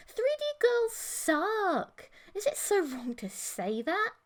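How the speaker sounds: put-on high, slow, breezy alien girlfriend; casual Emily. A narrator's reading. Emily dismissing his concern, breezily casual. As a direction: shrug it off lightly. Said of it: "Emily just shrugged".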